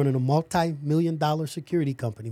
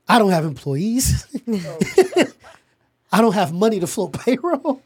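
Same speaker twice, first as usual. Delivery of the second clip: laughing; 6 semitones higher than usual. Real, not acted. Laughing while recalling having nothing.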